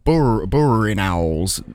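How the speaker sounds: "burrowing" said slowly in a Yorkshire accent. Real, not acted. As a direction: stumble on "bur-, burrowing" in a broad Yorkshire accent. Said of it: "It's hard to say with a Yorkshire accent".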